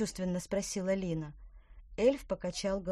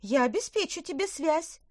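witch voice; 10 semitones higher, 5 dB louder than usual. Acted. Merlina promises.

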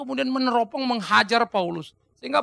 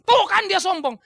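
higher, louder accusing jab; mocking opponent; 8 semitones higher, 6 dB louder, slower than usual. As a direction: accuse him gleefully. Voicing Paul's opponents. (lawan Paulus menuduh dengan nada tinggi)

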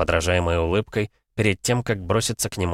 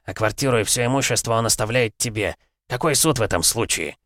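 father voice; 8 semitones higher than usual. A narrator's reading. Father lectures daughter.

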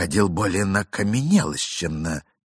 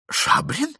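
puzzled echo. Acted.

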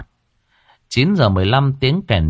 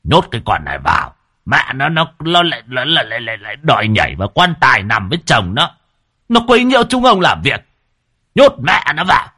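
put-on old elder ordering the youths, higher and louder; irate, raised voice of an old man in charge; stern commanding; 4 semitones higher, 4 dB louder, faster than usual. A narrator's reading. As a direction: order them about angrily, stumbling then climbing. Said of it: "nghiêm túc ra lệnh cho mấy hình thanh niên".

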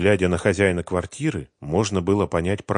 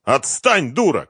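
high, loud irritated cry; sharp raised-voice outburst; shout; 8 semitones higher, 6 dB louder than usual. A narrator's reading. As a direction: shout to push someone away. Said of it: "крикнул Семён".

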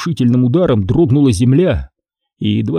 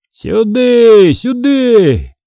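high, drawn-out calling cry; shouting; 10 semitones higher, 5 dB louder, much slower than usual. Acted. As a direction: call the riders over from afar. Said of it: "кричал Фома". (протяжный высокий крик)

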